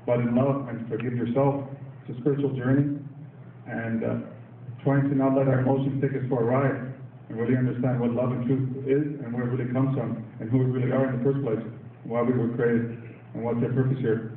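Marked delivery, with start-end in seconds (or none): none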